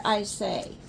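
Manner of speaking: ordinary delivery, nothing marked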